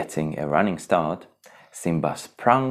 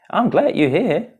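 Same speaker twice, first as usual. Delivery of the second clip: character voice; 13 semitones higher, 6 dB louder than usual. Acted. Simba voiced in story.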